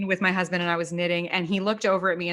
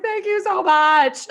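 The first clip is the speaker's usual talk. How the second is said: high, laughing thank-you; grateful knitter laughing at the lost marker found; 9 semitones higher, 6 dB louder, slower than usual. Retold or imitated. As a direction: gush thanks through a laugh. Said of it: "I was like".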